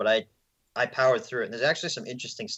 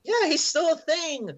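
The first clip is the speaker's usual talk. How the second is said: goofy voice; 4 dB louder than usual. Pretend. Mock reply to excited cohost.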